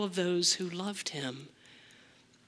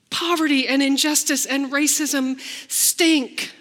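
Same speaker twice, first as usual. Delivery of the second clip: rising, louder, emphatic lament; preaching at full force; 6 semitones higher, 6 dB louder than usual. Real, not acted. Preacher crying out to the congregation about suffering, emphatic. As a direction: build the outcry higher and higher.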